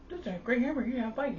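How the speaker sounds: a high, sing-song mom lost in her dream; mock sleep-talking mom. Telling how mom sat up in bed, in a high mock voice.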